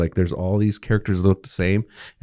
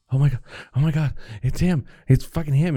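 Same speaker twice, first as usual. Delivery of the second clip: freaking out; 6 semitones higher than usual. Retold or imitated. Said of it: "she starts freaking out".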